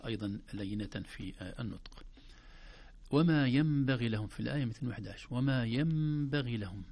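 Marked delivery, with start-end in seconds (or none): 3.1-4.3 وما ينبغي لهم chanted with the nun as mim; tajweed reading; Quranic recitation. Shown as a demonstration. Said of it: "حرف الميم فوق النون". 5.3-6.8 وما ينبغي لهم chanted with the nun as mim; tajweed reading; Quranic recitation. Shown as a demonstration. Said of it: "حرف الميم فوق النون".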